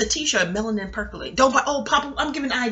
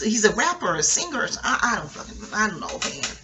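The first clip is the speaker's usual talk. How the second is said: ordinary delivery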